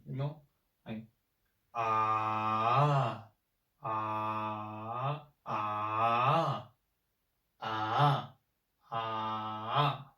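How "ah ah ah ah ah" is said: bend each ah down, then up; turning third tone. Shown as a demonstration. Teacher demonstrating the third tone's turn on ah. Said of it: "you turn the tone".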